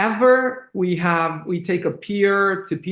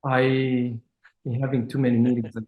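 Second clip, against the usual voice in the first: hoarse, strained voice; losing voice; 5 semitones lower, 3 dB quieter than usual. Real, not acted. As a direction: voice giving out. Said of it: "I'm losing my voice".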